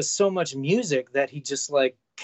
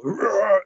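a short growled roar, louder; mock roar; 5 semitones higher, 6 dB louder than usual. Imitating a furious metal roar at his fans. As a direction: roar like an angry metal singer. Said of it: "this guy who's fucking super pissed".

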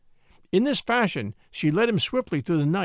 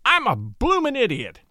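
raised, groaning self-reproach; 6 semitones higher than usual. A narrator's reading. Crosby realizing his money was in the vest, groaning. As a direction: groan at your own stupidity. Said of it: "he groaned".

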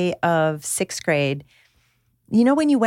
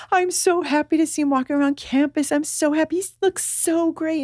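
high-pitched, mildly tearful gushing; imitated happy crying; 7 semitones higher than usual. New mother sees the boy walking around campus, imitated tearful joy. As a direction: pitch it up as if overcome with relief. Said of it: "she starts crying".

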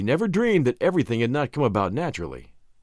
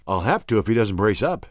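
usual speaking voice

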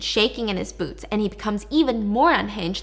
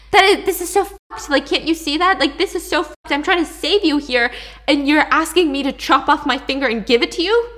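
higher, louder appalled outburst; upset outburst; 5 semitones higher, 6 dB louder than usual. Acted. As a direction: push back, horrified and loud. [name] refuses to cut finger.

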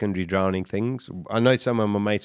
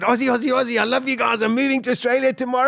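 high, gushing excitement, retold; acted high-pitched jubilant chant; elated cheering; 13 semitones higher, faster than usual. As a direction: cry out with overjoyed relief. Retold elated shouting at rescuers.

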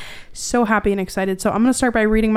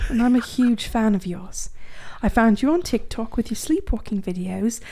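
put-on English accent for reading aloud. Host reading listener's letter on request, mock English accent. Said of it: "read in an English accent".

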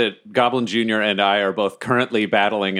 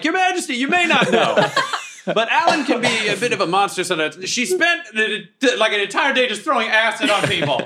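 high, strained calling voice; yelling over; 11 semitones higher than usual. Acted. Player's character warning His Majesty about Alan, high strained yell. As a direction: call it over in a high voice, laughter creeping in. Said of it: "I yell this over".

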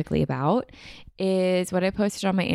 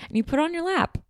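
mom's voice; 12 semitones higher than usual. Retold or imitated. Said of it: "And she's like".

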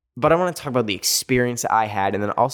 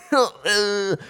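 a high, squeaky burst of laughter; high giggle; 10 semitones higher than usual. Real, not acted. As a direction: giggle high at the absurd announcement. Laughing at the lady's announcement.

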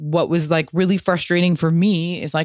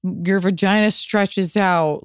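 high, whiny parody of the usual complaint; mock-whiny voice of the clichéd postpartum complaint; mocking the cliché; 6 semitones higher than usual. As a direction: send up the tired narrative, pitched up. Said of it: "bullshit narrative of".

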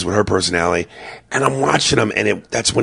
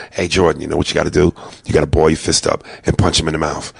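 tough dad advice; 6 semitones lower, faster than usual. Retold or imitated. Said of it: "and I go".

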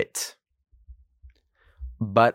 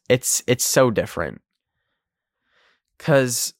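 ordinary talk

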